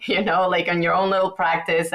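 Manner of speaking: normal talking voice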